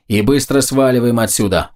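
ordinary delivery, nothing marked

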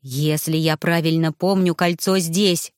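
ordinary delivery

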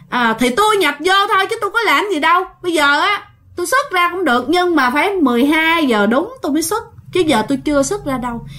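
high, casual spirit setting terms; unbothered spirit. Retold or imitated. Spirit telling everyone he leaves at midnight, high and casual. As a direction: state the terms calmly, no hurry. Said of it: "tỉnh bơ luôn".

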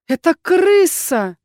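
stubbornly insisting, drawn out; insistent. A narrator's reading. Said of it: "не сдавалась я".

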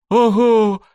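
loud, high cry of delight; delighted gasp. Acted. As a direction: squeal with amazement. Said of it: "восхищённый вопль".

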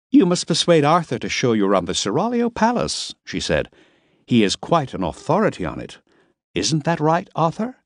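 Miss Lipp. A narrator's reading.

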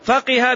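فقه said high and clear as "faqiha"; kasra on qaf. Shown as a demonstration. Teacher repeating for the class, فقه pronounced "faqiha". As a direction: raise the pitch and give فقه a "qi" middle. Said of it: "بكسر القاف".

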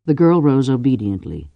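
usual speaking voice

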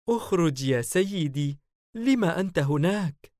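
high, loudish coaxing call; female character voice. A narrator's reading. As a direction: coax the master out, high-pitched. Su'luwa coaxes her master out. (صوت سعلوة وهي تناديه)

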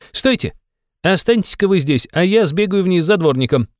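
high, eager youthful voice; excited young man. A narrator's reading. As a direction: halt him and volunteer eagerly, pitched high. Young man stops his companion. (взволнованно, высоким молодым голосом)

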